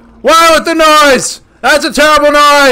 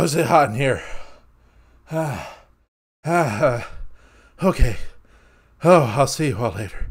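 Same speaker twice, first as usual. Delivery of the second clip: much softer, low, worn-out murmur; defeated; 9 dB quieter than usual. Real, not acted. Gamer giving up after the monsters win, soft defeated murmur. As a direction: give up, all fight gone.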